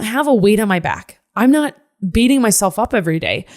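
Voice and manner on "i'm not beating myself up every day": ordinary delivery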